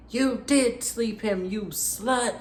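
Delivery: high, slow, hammed-up accusing sneer; accusing snarl. Jealous captor accusing his prisoner, high mock sneer. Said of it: "he says".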